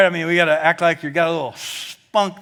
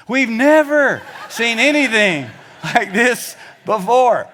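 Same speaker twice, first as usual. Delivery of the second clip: loud, high, slowed-down celebration; touchdown cheer; 5 semitones higher, 5 dB louder than usual. Shown as a demonstration. Kentucky fan voicing what fans shout after touchdowns. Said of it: "This is what we say after every touchdown".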